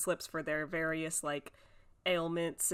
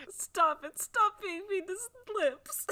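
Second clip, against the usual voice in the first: 1 mock pleading; 12 semitones higher, 3 dB louder, slower than usual. Pleading to stop teasing.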